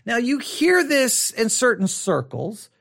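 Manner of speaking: ordinary talk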